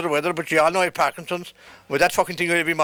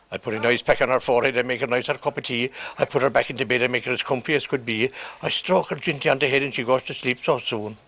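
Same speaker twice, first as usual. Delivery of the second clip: tender, low narration; 7 semitones lower than usual. Real, not acted. Tender care for a sick wife.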